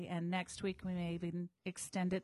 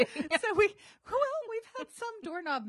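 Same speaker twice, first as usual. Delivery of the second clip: giggling while getting words out; laughing; 14 semitones higher, 7 dB louder than usual. Real, not acted. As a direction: still laughing, high and breathy. Laughing about doorknob mishaps.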